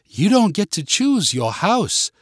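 slow, high British correction; British teacher. A narrator's reading. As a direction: set them straight like a British schoolmistress. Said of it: "sound like a British teacher".

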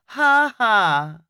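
flat mock laugh, sarcastic; a dry, sarcastic ha ha. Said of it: "sarcastically replying".

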